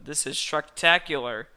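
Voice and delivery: loud high exclamation; a hammed-up party shout; excited cheer.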